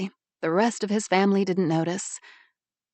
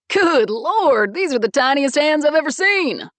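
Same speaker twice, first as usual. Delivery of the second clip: booming big guy; 8 semitones higher, 7 dB louder than usual. Acted. Big brother exclaims heartily.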